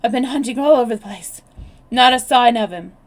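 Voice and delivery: loud, winded report after the chase; out of breath. A narrator's reading. Bill back from hunting the grounds, loud and winded. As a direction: push it out, panting from the hunt. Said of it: "He was out of breath and panting hard".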